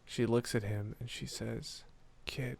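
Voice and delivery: soft, low storytelling voice; hushed intimate aside. Acted. Winifred kneeling to the kid, hushed and low. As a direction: keep it close and very quiet. Game master's hushed build to line.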